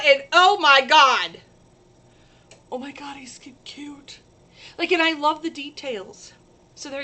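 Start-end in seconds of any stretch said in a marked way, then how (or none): 2.7-4.2 breathless whisper of delight. Real, not acted. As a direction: whisper the word in awe. Gushing whisper after he's cu.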